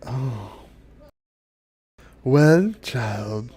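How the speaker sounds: slow dryad voice with long pauses; solemn dryad. Acted. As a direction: reveal it gravely, word by word. Dryad gravely tells the child.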